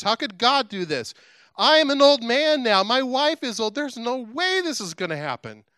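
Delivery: high, rising disbelief, dragged out for effect; incredulous protest. Retold or imitated. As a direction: object in disbelief, pitch climbing as you go. Acts out Zechariah's doubting protest to Gabriel.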